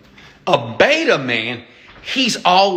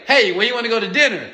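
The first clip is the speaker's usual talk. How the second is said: quick, high, unsure ask; mock weak invitation; 8 semitones higher, faster than usual. Imitating a man asking his date where to eat, raised and hurried. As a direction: toss the question off too fast and high. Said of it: "don't say".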